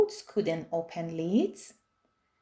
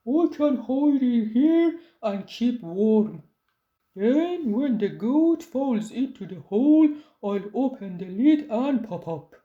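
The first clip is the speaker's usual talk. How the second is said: gruff-voiced troll, pleased; a pleased troll finding his hiding place; storybook troll, pleased; 2 semitones higher, 3 dB louder than usual. A narrator's reading. Troll scheming.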